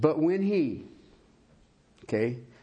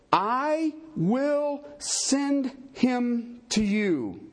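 scripture reading; 4 semitones lower, slower than usual. Acted. Reads John 16 aloud.

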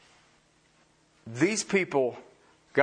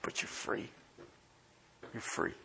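hushed whisper of freedom; soft unvoiced assurance; 13 dB quieter than usual. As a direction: drop to a bare whisper. Hushed close of sermon.

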